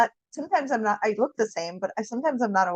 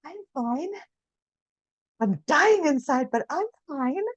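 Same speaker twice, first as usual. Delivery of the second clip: mock brave face; 6 semitones higher than usual. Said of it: "that's the fake us".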